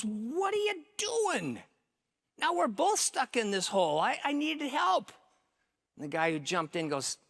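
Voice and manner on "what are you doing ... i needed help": very high, stretched, exasperated protest; incredulous outcry. Acted. Trapped man to the guy who jumped in, incredulous. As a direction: cry out in disbelief, pitch shooting up.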